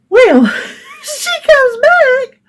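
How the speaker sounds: a big high burst of laughter, slowed right down. Real, not acted. Bursts out laughing retelling call.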